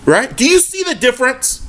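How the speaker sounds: getting excited. Real, not acted. Fan fired up about Lakers trade targets, excited. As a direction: let the excitement run away. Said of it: "I'm getting excited".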